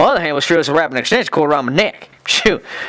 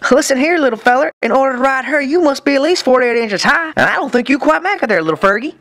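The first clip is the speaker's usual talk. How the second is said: mocking carnival barker; 10 semitones higher than usual. Mocking the midget, ride sign.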